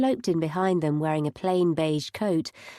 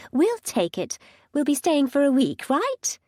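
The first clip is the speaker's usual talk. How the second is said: eager mum voice; 7 semitones higher, 3 dB louder than usual. A narrator's reading. Mum eagerly books room.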